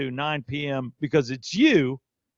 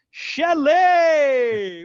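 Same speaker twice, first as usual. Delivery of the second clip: loud drawn-out name call; 11 semitones higher, 7 dB louder than usual. Real, not acted. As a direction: hail the guest like a big introduction. Host calls out guest's name.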